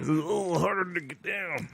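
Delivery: high, squeezed voice after a harsh swallow; struggling to speak past the burn; strained from scotch. Real, not acted. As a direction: choke it out as the drink goes down.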